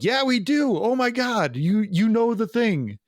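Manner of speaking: high, hammed-up excitement; excited fans. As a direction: imitate a thrilled fan recognizing themselves. Acts out excited fans agreeing.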